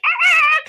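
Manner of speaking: high, loud whining cry; imitated dog whine. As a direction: mimic a hurt dog's yelp. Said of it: "like, whining, like".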